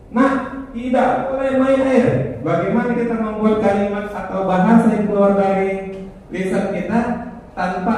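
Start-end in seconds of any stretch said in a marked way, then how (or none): 0.1-2.1 put-on high-pitched, drawn-out no to a child; mock parental prohibition. Teacher showing the wrong way to forbid a child, prohibiting.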